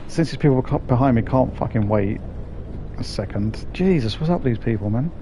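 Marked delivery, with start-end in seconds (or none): none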